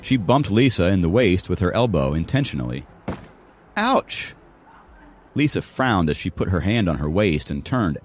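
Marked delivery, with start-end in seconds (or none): 3.8-4.3 high-pitched yelp of pain; pained ouch. A narrator's reading. Girl elbowed in the waist, pained yelp. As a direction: cry out from a sudden jab.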